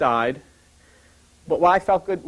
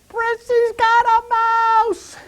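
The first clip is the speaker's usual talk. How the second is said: much higher, slow, shrill-sounding wife quote; wife's alarmed voice; 3 dB louder, much slower than usual. Retold or imitated. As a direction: imitate a panicked wife calling out slowly. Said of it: "And she says".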